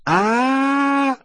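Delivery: high sharp cry of pain; scream of pain. A narrator's reading. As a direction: cry out as if burned. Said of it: "закричала от боли". (крик боли)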